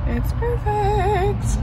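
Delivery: drawn out delight. Real, not acted. Admiring new car.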